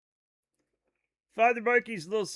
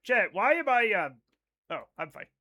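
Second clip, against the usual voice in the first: normal talking voice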